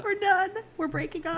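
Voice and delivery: high, loud playful declaration; mock breakup. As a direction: call it over in fake outrage.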